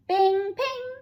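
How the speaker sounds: high, loud chiming bing ping; high ringing ping. A narrator's reading. Narrator sounding the godmother's pistol, high ringing chime. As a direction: ring it out like a magic shot.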